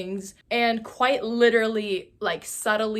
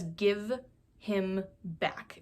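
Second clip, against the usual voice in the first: slow, drawn-out creepy demand; intense child voice; 5 dB quieter, much slower than usual. Retold or imitated. Child demanding the doll back, slow intense voice. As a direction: imitate an intense child, word by heavy word. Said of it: "the most intense voice I've ever heard".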